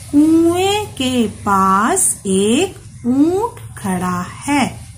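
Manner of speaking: slow, clear reading aloud; reading to children. Acted. Teacher reading the lesson passage slowly to the children. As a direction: read each phrase slowly for young learners.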